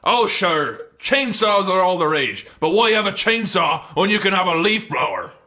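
Scottish salesman's patter; Scottish accent. Acted. Shopkeeper talking customer into a leaf blower, Scottish accent. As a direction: pitch it in a rolling brogue. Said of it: "Are you Scottish?".

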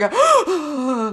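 high, exaggerated shocked gasp; imitated gasp at a cold splash; cold-water gasp. Podcaster showing being sprayed in the face, acted gasp. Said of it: "like this".

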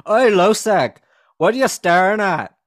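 loud, high-pitched Scottish grandma; Scottish accent. Retold or imitated. Friend's grandma confronts a staring six-year-old, loud Scottish accent. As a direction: imitate a feisty Scottish granny challenging a staring child. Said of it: "grandparents and shit who were Scottish".

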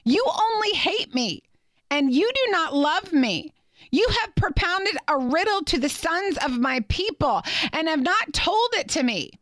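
mildly mock the wife's tearful complaint; hint of a weeping wife. Preacher voicing the wife accusing Samson, mildly whiny. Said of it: "Samson's wife wept before him".

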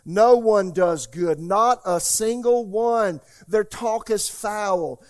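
loud, slow, building pulpit reading; preaching scripture. Acted. Pastor reading Romans to the church, preaching loudly. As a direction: thunder the verses at the congregation. Reads Romans three aloud.

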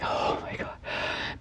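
barely audible gasped whisper; awed whispered relief. Retold or imitated. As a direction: breathe it out in disbelief. Retold relief after near miss.

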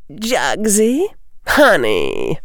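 mock-sweet pet names, starting high; sugary villainess. Belka addresses Jugson, cloying and vicious. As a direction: coo at your partner with menace underneath. Vicious bully coos at partner.

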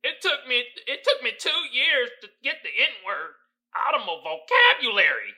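sneering take-off of the founder's words; mocking Papa John. Comedian mocks the Papa John's founder's quote, in a put-on voice. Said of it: "had the nerve to say this bull".

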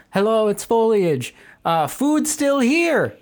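very high, slow mimicking voice; high-pitched slow cashier mimic; high imitation of cashier.

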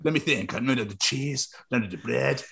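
musing cartoon Frenchman, French accent; fluster, then think aloud in a French accent; mock French accent. Co-host hunting for French foods, put-on French accent. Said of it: "you've been a Frenchman".

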